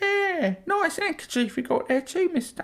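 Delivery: high squeaky Cockney street urchin; old london cockney. Acted. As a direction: Victorian urchin Cockney accent.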